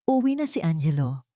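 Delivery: usual speaking voice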